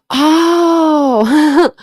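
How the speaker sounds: sharp gasp, then a high startled oh; shocked gasp. Real, not acted. Streamer gasps at a chat comment, high and startled. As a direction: catch your breath in real surprise.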